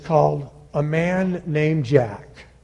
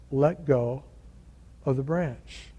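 softer, measured command; calm instruction; 3 semitones lower, 12 dB quieter than usual. Acted. God telling Jack to release the branch, calm instruction. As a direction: say it quietly, with a pause midway.

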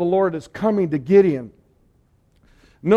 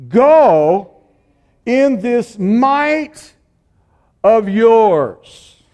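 commanding; 4 semitones higher, 10 dB louder, much slower than usual. Acted. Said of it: "the Lord turned to him and said".

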